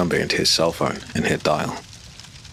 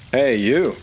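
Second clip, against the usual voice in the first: calling out; 8 semitones higher than usual. A narrator's reading. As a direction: hail him from far off. Stranger calls across lobby.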